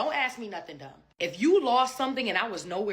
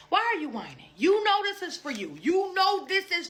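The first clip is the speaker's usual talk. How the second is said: exasperated scolding; 11 semitones higher than usual. Real, not acted. Scolding whining kids.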